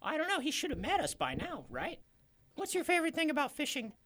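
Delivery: very high squeaky fisherman. Acted.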